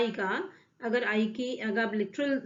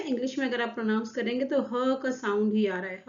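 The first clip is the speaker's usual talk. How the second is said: ordinary delivery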